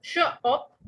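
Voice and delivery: teacher splitting the word; syllables split. Shown as a demonstration. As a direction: tap out श then ऑप. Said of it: "ऑप जो last syllable है वो stressed है".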